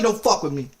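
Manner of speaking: ordinary talk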